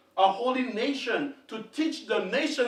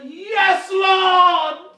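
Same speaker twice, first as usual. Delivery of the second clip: high, loud cries of Yes, Lord; loud humble cry; 8 semitones higher, 10 dB louder, much slower than usual. Acted. The woman answering the Lord, loud and fervent. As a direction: cry out in full surrender.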